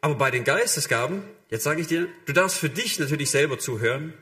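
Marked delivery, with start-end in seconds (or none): none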